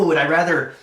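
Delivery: ordinary delivery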